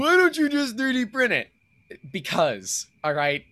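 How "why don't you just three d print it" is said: high, loud mocking question; mock questioner. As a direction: whine it like a heckler.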